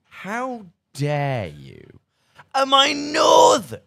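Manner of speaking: hammed-up indignant roar, high and loud; mock-offended bellow; mock outrage. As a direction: play the insulted northerner, huffing and big. Mock offended at being called northern.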